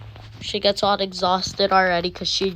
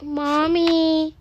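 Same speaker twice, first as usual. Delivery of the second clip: put-on high kid voice calling mom; high-pitched kid calling; 9 semitones higher, 4 dB louder than usual. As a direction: call out like a little boy. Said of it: "he's like".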